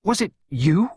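halting, appalled question; gasped. A narrator's reading. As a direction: gasp it out in horror. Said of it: "she gasped".

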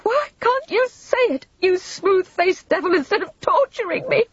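high, loud, overwrought accusation; piercing angry tirade; shrill cry. A narrator's reading. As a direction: lash out shrilly, nerves at breaking point. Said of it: "cried Vivian shrilly".

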